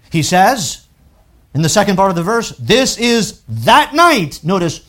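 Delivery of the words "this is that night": drawn-out, raised This is that night; slow emphasis. Shown as a demonstration. Preacher reading Exodus aloud, stretching the phrase slowly.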